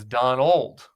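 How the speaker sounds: put-on "Don Old" split in two, with weight on "Old"; misplaced emphasis. Shown as a demonstration. Host passing on a fan's dad joke, "Don Old" with stressed second word. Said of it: "do the same and refer to him as".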